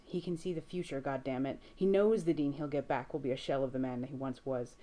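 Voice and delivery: plain reading voice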